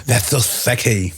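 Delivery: slow, mock-seductive murmur; slow teasing seductive line. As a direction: purr it slowly like a joke flirt. Mock seductive comment, others react.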